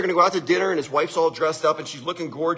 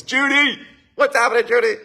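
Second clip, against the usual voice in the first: high, hearty shout-out; real greeting, pitched up and called; calling out; 6 semitones higher than usual. Real, not acted. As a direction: hail a friend across the room. Calling out to a viewer by name.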